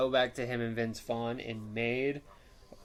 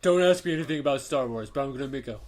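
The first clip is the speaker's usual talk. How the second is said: louder, smug and grumbling; grumpy star voice; 3 semitones higher, 8 dB louder than usual. Retold or imitated. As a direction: play the greedy grouchy star. Voicing Harrison Ford's attitude.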